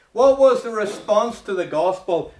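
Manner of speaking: high and loud repeat of the question; preaching loud. Shown as a demonstration. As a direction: hammer the question home loudly. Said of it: "repeated question, second louder".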